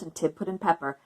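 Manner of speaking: usual speaking voice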